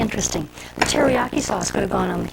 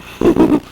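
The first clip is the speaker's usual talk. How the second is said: short laugh; 10 semitones higher, 5 dB louder than usual. Real, not acted. Laughs after tasting the sundae.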